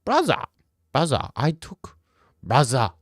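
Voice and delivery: broken-up bad Dagestani accent with a lisp; unsure mock-Dagestani attempt; halting Dagestani accent. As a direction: feel out a Dagestani accent phrase by phrase. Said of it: "like, a Dagestani with, like, a speech impediment".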